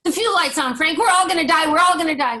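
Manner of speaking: high, loud panicked wail; cartoon panic. Pilot panicking over the intercom, loud and high-pitched. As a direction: shriek it like the plane is going down. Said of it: "I love this cartoon".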